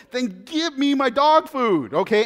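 mock-firm insistence at the guard; indignant demand. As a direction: put your foot down with the store guard. Retold standoff with store guard.

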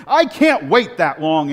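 loud, slow indignant complaint; indignant huff. Retold or imitated. A shopper huffing at the server, loud and indignant. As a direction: huff it out before storming off. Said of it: "she goes storming off".

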